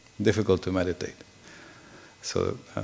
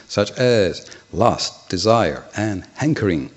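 measured, declaimed verse translation; formal scripture reading; 2 semitones higher, 4 dB louder than usual. Real, not acted. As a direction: read it out deliberately, phrase by phrase. Reads the translation aloud.

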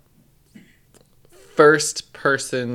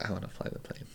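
whisper; 3 semitones lower, 17 dB quieter, faster than usual. Retold or imitated. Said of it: "I was like".

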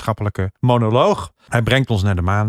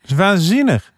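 amazed; 9 semitones higher, 3 dB louder than usual. Real, not acted. Marvelling at moon rock story.

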